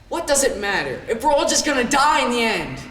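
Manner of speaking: dramatic outburst. Acted. Monologue narrator questioning the point of it all, dramatic outburst. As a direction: cry it out, pitch and volume climbing.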